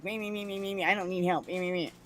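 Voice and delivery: mocking her self-centred chatter, high and quiet; mocking coworker. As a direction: parody her as a babbling me-me-me. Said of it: "she was just like".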